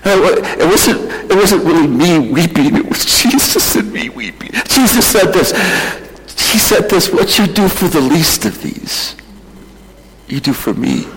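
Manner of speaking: choked, high-pitched tearful testimony; weepy. Real, not acted. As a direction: push the words out through tears. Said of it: "I seem a little weepy".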